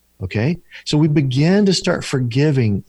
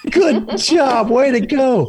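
high, louder, laughing through it; mock praise; 12 semitones higher, 4 dB louder, slower than usual. Echoing the host's joke about the client, laughing mock cheer. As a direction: tease as if cheering a small feat.

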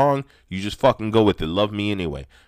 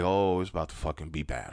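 scared hush; 5 semitones lower, 8 dB quieter than usual. Retold or imitated. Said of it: "Brother looked at me like".

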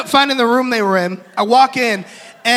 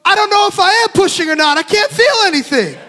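higher-pitched distressed wife in labour; imitating a flustered woman mid-labour; panicked protest; 7 semitones higher than usual. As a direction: protest helplessly, pitch raised. Wife voiced, panicking during labour.

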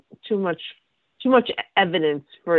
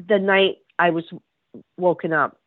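usual speaking voice

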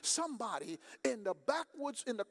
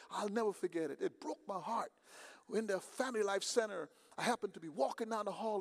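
normal talking voice

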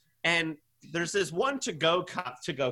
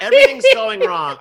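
laughing rant; 14 semitones higher, 13 dB louder than usual. Real, not acted. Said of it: "I just need a vent".

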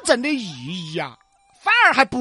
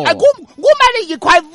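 put-on higher, louder chatty old auntie; old granny voice; 7 semitones higher, 10 dB louder, faster than usual. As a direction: play a loud Guang'an granny. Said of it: "两个广安老妹儿们儿".

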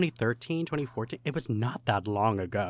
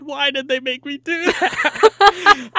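exasperated; 10 dB louder, slower than usual. Real, not acted. Exasperated disbelief at the staging.

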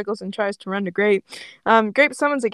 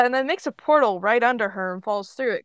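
plain reading voice

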